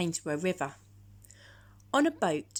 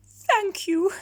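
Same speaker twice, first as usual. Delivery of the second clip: high, loud, whining giraffe; a high plaintive animal voice, much higher and louder; 13 semitones higher, 9 dB louder than usual. Acted. Giraffe complaining to George about its cold neck, high and whiny. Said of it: "said the giraffe".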